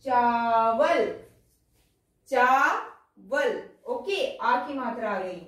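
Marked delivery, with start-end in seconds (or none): 0.0-1.1 चावल drawn out slowly with a long आ; slow corrected reading. Shown as a demonstration. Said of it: "हम यहाँ बनाएंगे इसको". 2.3-3.6 चावल drawn out very slowly, long आ held; very slow reading. Shown as a demonstration. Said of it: "हम यहाँ बनाएंगे इसको".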